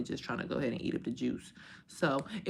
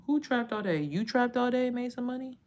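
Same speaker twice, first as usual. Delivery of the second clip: mocking reply; 8 semitones higher than usual. Mocking a viewer comment.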